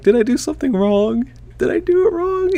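very high, strained panic squeak; panicked squeak. Retold or imitated. Groom panicking that the proposal went wrong, very high squeak. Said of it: "one of those moments where I'm like".

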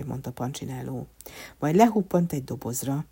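ordinary delivery, nothing marked

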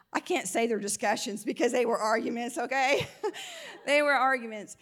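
sheepish laughing confession; laughing admission. Real, not acted. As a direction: own up with a laugh. Laughing admission about marital fights.

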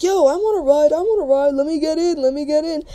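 high-pitched pleading chant; imitated clamoring crowd. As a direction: mimic everyone begging at once, pitched high. Crowd begging for a ride.

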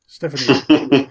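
loud, slow, drawn-out Russian dub; mock Russian dubbing voice. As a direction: call the name like a flat Russian overdub. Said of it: "with the Russian voice just going".

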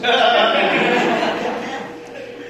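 a short rising laugh; amused laugh. Real, not acted. Pastor laughing at his own bold promise, rising laugh. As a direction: laugh it off with the crowd.

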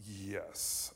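low, very soft admission; sheepish confession. Retold or imitated. Kid caught by his mom, sheepish and quiet. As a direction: own up quietly after a long pause.

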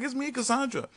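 high, light voice of the date; man imitating Cassandra, high and light; mock girl voice. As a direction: play her sweetly introducing herself. Voicing date again.

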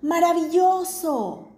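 high, drawn-out joyful shout; the father shouts into the cave to show his son; father's shout. Acted. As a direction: call it out with delight into the cave. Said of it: "el papá gritó".

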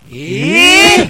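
loud, drawn-out "ehh!" of shock; astonished. Real, not acted. Co-host reacts to the shakedown story, astonished. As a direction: let out a loud cry of disbelief.

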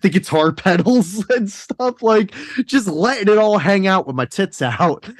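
telling it while cracking up; laughing. Real, not acted. Laughing while retelling story.

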